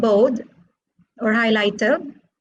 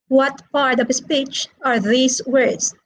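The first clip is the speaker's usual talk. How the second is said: ordinary talk, nothing marked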